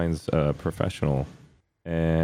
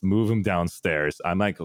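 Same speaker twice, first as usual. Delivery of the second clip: ordinary talk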